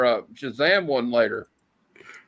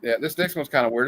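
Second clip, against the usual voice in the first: normal talking voice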